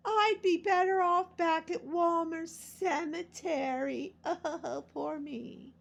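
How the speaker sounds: high, slow self-pitying whine; imitating mom. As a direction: mimic mom moaning about herself. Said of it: "One of my mom's favorite was to say".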